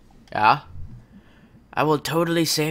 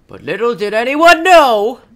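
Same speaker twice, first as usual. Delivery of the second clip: loud, higher ominous announcer; dramatic narration; 5 semitones higher, 11 dB louder than usual. Acted. Narrator warning the audience, loud dramatic. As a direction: boom it like a spooky narrator.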